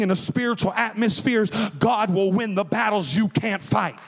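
rising sermon cry over organ; full-force preaching. Acted. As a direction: preach it hard, building to a climax. Preaching over music, climbing.

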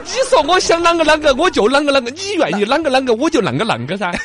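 重庆话调侃; Chongqing accent. Pretend. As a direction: tease with rapid Chongqing wordplay. Chongqing 啷个 riff.

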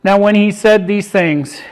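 usual speaking voice

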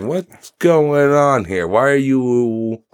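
slow, mock-incredulous reaction; acted bafflement. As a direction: stretch each word in exaggerated disbelief. Acts out his baffled reaction.

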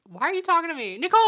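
yell, baffled, at the stranger; confused loud call. Retold or imitated. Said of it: "And I said".

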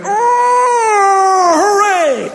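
very high, squealed hooray; mock cat cheer. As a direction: squeal it like a delighted cartoon cat. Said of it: "He's not gonna be like".

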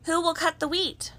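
high, brisk calling out. A narrator's reading. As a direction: call out briskly across the barnyard. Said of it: "calling briskly".

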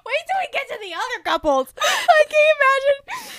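high, laughing squeal; giddy laughing. Real, not acted. Fan laughing to her co-host about other couples, giddy. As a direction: laugh through the words in delight.

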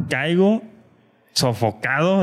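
usual speaking voice

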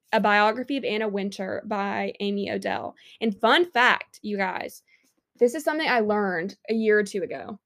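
usual speaking voice

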